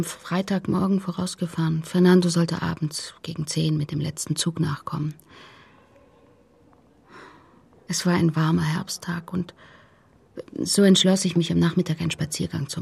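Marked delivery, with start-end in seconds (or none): 1.8-9.5 hushed, unvoiced recollection; barely voiced account; whispered telling. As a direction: tell it in a whisper. Mother recounting the accident.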